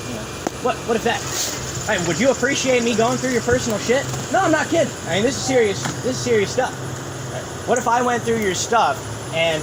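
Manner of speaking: heated angry rant. Real, not acted. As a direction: rant at the cop about privacy. Arguing about respect with officer.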